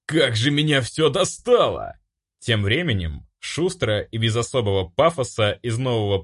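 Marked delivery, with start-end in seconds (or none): 0.1-2.0 raised, exasperated exclamation; furious outburst. A narrator's reading. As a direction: complain at the end of your patience. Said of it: "бешено заорал я".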